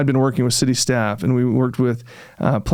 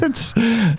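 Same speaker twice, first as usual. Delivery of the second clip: short high burst of disbelieving laughter; one sharp laugh at a silly idea; incredulous laugh; 10 semitones higher than usual. Real, not acted. Laughing at absurd suggestion.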